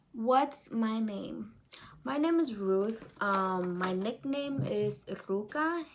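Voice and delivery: ordinary talk, nothing marked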